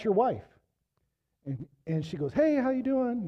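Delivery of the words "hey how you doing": high, chirpy greeting; bubbly hello, pitched well up; bubbly Bev. Retold or imitated. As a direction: sing out a cheery hello. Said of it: "You know, bubbly Bev".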